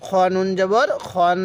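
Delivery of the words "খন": rasped kh, then a sustained nun in "খন"; ghunnah. Shown as a demonstration. Teacher showing learners the ghunnah, "খন" ending in a long hum. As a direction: hold a long nasal hum on the n of "খন". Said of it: "নুনের সাথে খকে লাগিয়ে গুন্নাহ করব".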